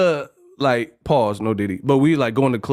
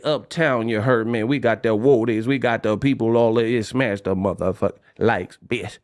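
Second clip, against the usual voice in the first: mock New Orleans talk; 4 semitones lower than usual. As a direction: imitate Uptown locals, low and lazy. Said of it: "I said".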